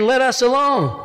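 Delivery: high-pitched cry without added volume. Acted. Demon in church cries out to Jesus, crying out. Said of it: "cried out with a loud voice".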